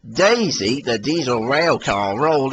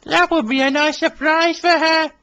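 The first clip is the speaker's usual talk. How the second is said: Billy voice. Acted.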